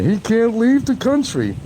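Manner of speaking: high, loud slow mocking voice; high loud mocking impression of the trolls. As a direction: parrot the trolls in a high sneer. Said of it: "all the trolls say".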